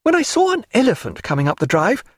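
high, light woman's voice with a smile; resume brightly with a smile, pitched high; smiling Diana. Acted. Diana turning back to Stephen after the servant, smiling high voice. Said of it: "she said, smiling again".

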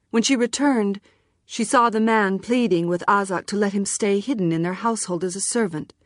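plain reading voice

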